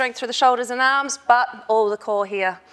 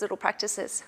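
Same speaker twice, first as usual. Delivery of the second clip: repeated much softer, trailing away; softer echo; 5 semitones lower, 11 dB quieter than usual. Shown as a demonstration. Coach echoing her advice to viewers, softer and trailing off. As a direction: let the repeat fall away to a soft echo. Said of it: "So do little practices".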